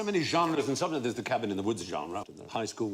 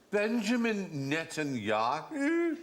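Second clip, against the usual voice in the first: slow, higher Scottish drawl of the name; Billy Connolly impression; 7 semitones higher, slower than usual. Connolly on the phone, slow Scottish riff. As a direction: roll the name out slowly in Scots. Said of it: "He said".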